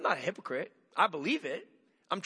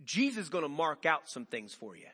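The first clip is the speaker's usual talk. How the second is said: normal talking voice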